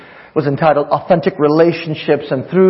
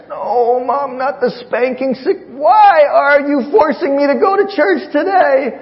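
pleading son; 11 semitones higher than usual. Retold or imitated. Son pleading against spanking.

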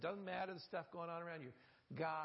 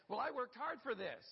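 higher, louder, self-satisfied claim; mock proud boast; 6 semitones higher, 4 dB louder than usual. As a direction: play the proud person taking credit. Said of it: "because we say".

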